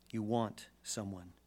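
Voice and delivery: ordinary delivery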